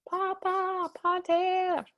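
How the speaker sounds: put-on high little boy calling papa; child calling dad. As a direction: call out to your father like a small boy. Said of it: "He's calling his dad".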